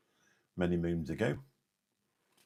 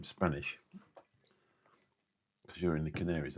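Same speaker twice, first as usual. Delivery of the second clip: normal talking voice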